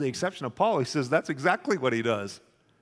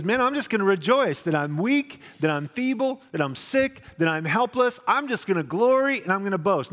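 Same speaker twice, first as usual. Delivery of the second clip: high, loud joyful proclaiming; exultant Paul; 6 semitones higher, 4 dB louder than usual. Retold or imitated. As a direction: rejoice out loud like Paul boasting. Preacher acting out Paul's rejoicing.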